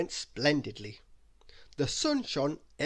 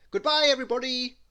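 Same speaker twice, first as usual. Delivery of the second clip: high, louder called goodbye; 11 semitones higher, 8 dB louder than usual. A narrator's reading. Mister Arthur Loaf, waving off his guests, calling out. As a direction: call out to everyone. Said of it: "called Mister Arthur Loaf".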